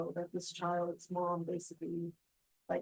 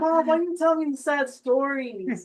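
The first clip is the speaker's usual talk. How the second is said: whiny complaint to mom; 7 semitones higher, 10 dB louder, slower than usual. Retold or imitated.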